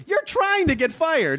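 high, slow incredulous quip; mock exasperation. Friend voicing the boss's disbelief, high and slow. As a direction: say it as if baffled at his antics. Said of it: "It was almost like".

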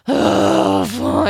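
low, heavy resigned groan; reluctant. Retold or imitated. Dog walking head down into the crate, reluctant. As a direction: give in with a deep, drawn-out sigh of a voice. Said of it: "He very reluctantly".

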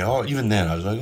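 ordinary delivery

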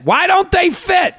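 high, slow, shouted demand; nagging parent. Acted. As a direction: press the kid, stretching each word. Parent pressing in acted quarrel.

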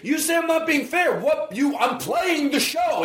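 defending himself against the fairness complaint, angrily; angry. Real, not acted. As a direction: push back hard, raising your voice. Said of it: "But you're giving angry".

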